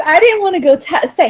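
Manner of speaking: normal talking voice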